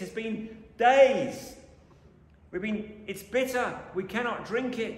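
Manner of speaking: grumbling Israelites. Retold or imitated. Preacher voicing the thirsty Israelites, whiny grumble. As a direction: moan like tired, thirsty travellers. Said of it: "So they grumble".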